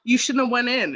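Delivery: angry driver yelling. Retold or imitated. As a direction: snap at the other driver. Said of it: "being like".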